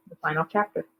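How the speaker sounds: ordinary delivery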